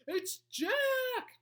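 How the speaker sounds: high, very loud wail; terrified bellow. A narrator's reading. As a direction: bellow it out in panic. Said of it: "bellowed the flesh lump eater".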